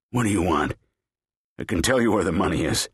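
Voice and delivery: put-on panicked old man offering the money; frightened bargaining. A narrator's reading. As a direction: rush the offer out in fear.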